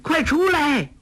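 very high, urgent cry; panicked high-pitched yell; calling out. A narrator's reading. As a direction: call frantically for the others to get out. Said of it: "叫道".

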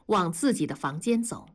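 ordinary delivery

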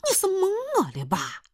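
can't believe what she's hearing; incredulous. Retold or imitated. The storyteller asking the stranger if he's fooling her, incredulous.

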